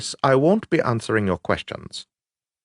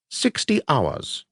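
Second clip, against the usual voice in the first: slow deliberate; 5 semitones higher, 4 dB quieter, slower than usual. A narrator's reading. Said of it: "she said to me".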